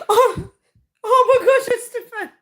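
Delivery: high squealing laugh through the words. Real, not acted. Streamer laughing helplessly at a chat comment about dodging water. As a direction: burst out laughing at a chat message.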